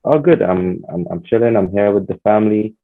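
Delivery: usual speaking voice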